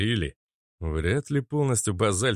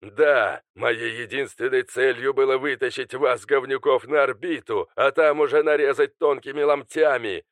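higher, mocking villain voice; sneering villain; 5 semitones higher, 4 dB louder than usual. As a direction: spit out the threats with contempt. Alister admits his aim.

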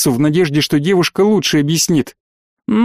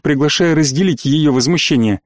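plain reading voice

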